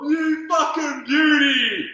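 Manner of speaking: slow, drawn-out high yell of joy; excited shout. Real, not acted. Friend cheers the goal, slow drawn-out high yell. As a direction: bellow it out in triumph.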